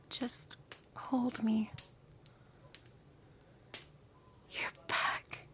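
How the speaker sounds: slow whisper fading to a breath; fading tender whisper. Acted. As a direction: sink into the embrace, barely audible.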